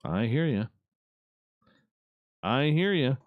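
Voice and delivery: usual speaking voice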